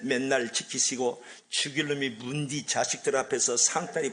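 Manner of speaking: slow Gyeongsang dialect recitation; rough Gyeongsang accent. Shown as a demonstration. Preacher giving the fierce Gyeongsang version of the psalm, Gyeongsang accent. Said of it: "경상도 버전 좀 싸납습니다".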